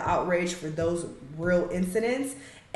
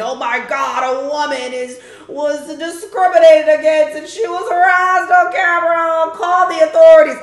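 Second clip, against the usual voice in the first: hammed-up outraged gasp, higher and much louder; mock outrage; 7 semitones higher, 9 dB louder than usual. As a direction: parody the hysterical internet crowd. Mocking the internet's outrage.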